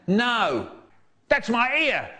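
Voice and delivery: high-pitched protesting yell; shouting in struggle. Acted. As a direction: cry out while fighting someone off. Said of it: "someone shouting".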